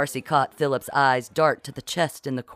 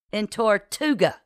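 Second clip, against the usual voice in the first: higher-pitched bitter finish; 6 semitones higher than usual. A narrator's reading. Kit finishes exposing Francois, bitter. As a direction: land the place name like an accusation. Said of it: "Kit supplied bitterly".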